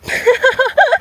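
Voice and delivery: high-pitched burst of laughter. Real, not acted. Laughing before asking for likes.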